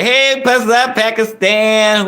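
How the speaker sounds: high, slow sing-song greeting; excited greeting. Real, not acted. Streamer greets a viewer from Pakistan, high and drawn out. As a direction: stretch out the hello playfully.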